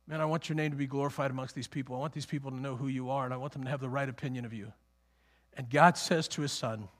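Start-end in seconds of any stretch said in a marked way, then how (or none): 0.1-4.7 low, soft, quick plea; quiet earnest prayer. Acted. Preacher as the Son talking to God, quietly earnest. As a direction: pray it privately in a fast, lowered voice.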